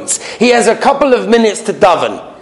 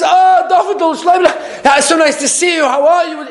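rich friend's greeting; 8 semitones higher than usual. Retold or imitated. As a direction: gush over him like an old friend. Rich man greets him again.

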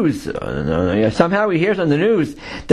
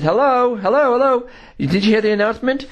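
lightly acted higher-pitched hail; officer calling out; 7 semitones higher than usual. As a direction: call up to a resident, raised pitch, ordinary volume. Police officer calling to resident.

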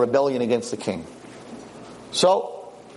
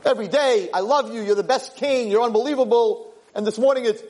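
high-pitched, worked-up protest; indignant complaint; 7 semitones higher than usual. Acted. King questioning the boy's good morning, indignant. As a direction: plead in an aggrieved high voice.

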